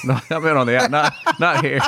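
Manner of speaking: laughing while correcting himself. Real, not acted. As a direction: laugh through the words. Laughing at his own slip.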